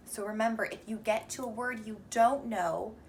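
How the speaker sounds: normal talking voice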